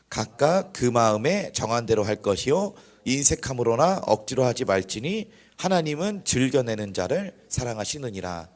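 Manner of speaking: slow, measured congregational reading; solemn recitation, drawn-out phrase ends; scripture reading. Real, not acted. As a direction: lead the verse aloud in deliberate phrases. Reads scripture aloud for congregation.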